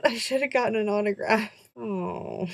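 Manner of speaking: playful fake sob; hammed-up lament; mock crying. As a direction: whimper it like a pretend heartbreak. Mock despair at skipped autograph.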